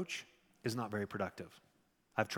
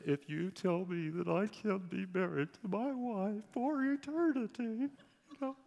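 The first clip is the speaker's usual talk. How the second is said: mock tearful plea; 11 semitones higher than usual. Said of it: "look at me with big tears in their eyes".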